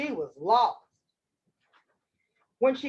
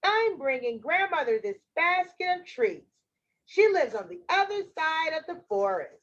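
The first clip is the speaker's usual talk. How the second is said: put-on higher-pitched little girl; chatty child, pitched up; 4 semitones higher than usual. Girl explains her errand to the wolf, higher child voice.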